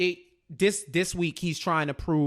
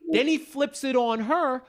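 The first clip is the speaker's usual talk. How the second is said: ordinary talk, nothing marked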